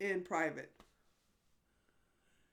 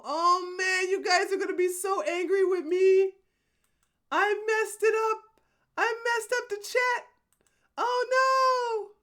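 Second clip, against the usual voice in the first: panicked whine; 8 semitones higher, 5 dB louder than usual. Real, not acted. Fears chat's anger.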